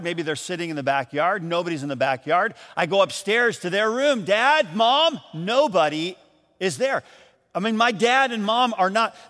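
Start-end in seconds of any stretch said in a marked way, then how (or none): 4.2-5.2 calling out. Retold or imitated. Calling through the house.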